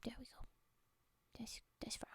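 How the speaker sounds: soft breathy whisper close to the mic. Real, not acted. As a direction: whisper it gently. ASMR streamer whispering.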